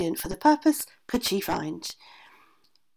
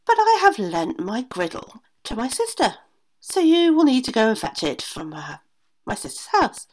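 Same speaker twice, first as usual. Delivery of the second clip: a hint of a scheming witch giving orders; 5 semitones higher, 5 dB louder than usual. A narrator's reading. Witch to her daughter-in-law, lightly voiced scheming witch. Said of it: "and told her".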